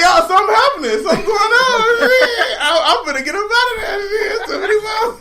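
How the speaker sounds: very high, squealing through laughter; joke-panic while cracking up; laughing mock alarm. Real, not acted. Host joking about fleeing the Mo's, laughing high.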